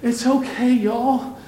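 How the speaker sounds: lightly voiced bride calming the room; imitating daughter. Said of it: "just says".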